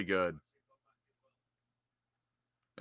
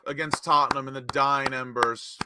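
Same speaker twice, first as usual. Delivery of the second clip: higher, announcer-style match commentary; live play-by-play; 6 semitones higher, 4 dB louder than usual. Real, not acted. Commentator hailing a club legend coming on, raised announcer voice. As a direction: call the substitution with a raised, projected voice.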